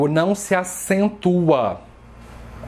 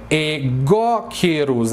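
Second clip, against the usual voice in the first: egoqueros as e-GÓ-queros, GÓ raised and held; proparoxytone stress; much slower than usual. Shown as a demonstration. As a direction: slowly lift the GÓ in egoqueros. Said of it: "É uma proparoxítona".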